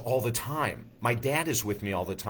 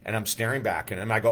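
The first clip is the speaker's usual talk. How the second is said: ordinary talk, nothing marked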